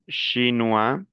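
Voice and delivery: "Chinois" ending on an open wa, rising; silent final S. Shown as a demonstration. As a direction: leave the s off "Chinois". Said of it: "las S finales no se pronuncian". ("Chinois" terminado en wa, sin la s)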